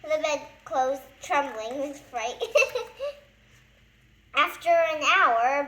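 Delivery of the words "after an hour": laughing. Real, not acted.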